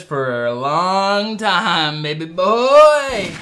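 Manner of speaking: slow, stretched hype delivery, rising loud and high; hammed-up showman's stretch; playful drawn-out boast. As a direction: draw out every word into a showy holler. Showy reply with stretched last words.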